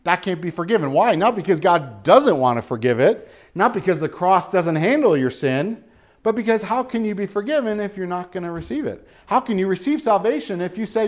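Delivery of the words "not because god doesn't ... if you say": ordinary delivery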